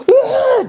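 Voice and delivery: sharp high yelp. Retold or imitated. Sudden yelp mid story.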